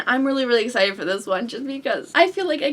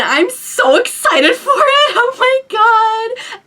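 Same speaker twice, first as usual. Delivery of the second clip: high, loud fan gushing; real excitement; 10 semitones higher, 5 dB louder, slower than usual. Real, not acted. Book fan recalling a huge cliffhanger, bursting with excitement.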